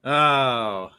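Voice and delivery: ordinary delivery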